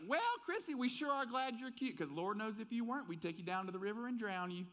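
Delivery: high, sugary Southern lady voice, sinking as she goes; sweet Southern boutique owner. Retold or imitated. Boutique owner greeting the new girlfriend, high sugary Southern lady voice. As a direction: start way up high and sweet, then let the threat slide down. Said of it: "and she's like".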